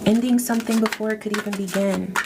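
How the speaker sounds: usual speaking voice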